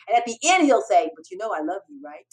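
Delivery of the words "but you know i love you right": much softer, affectionate aside; soft make-up after a scolding; retold tender reassurance. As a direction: drop the volume and reassure lovingly. Mentor softens after yelling.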